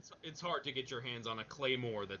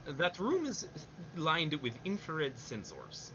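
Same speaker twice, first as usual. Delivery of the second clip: put-on higher, slower Russian-accented sensors; imitating Nastasha warning Snake; Nastasha's Russian accent; 4 semitones higher than usual. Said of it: "hear her say the word sensors".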